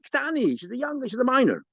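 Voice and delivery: a defensive, high protest. Retold or imitated. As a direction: blurt it out high in his own defense. Rav Nachman defends himself.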